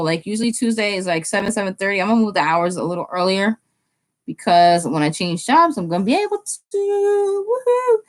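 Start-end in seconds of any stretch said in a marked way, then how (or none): 6.0-8.0 high, excited squeal; celebratory whoop. Real, not acted. Streamer celebrating a coming job change, whooping. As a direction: let it climb into a high woohoo.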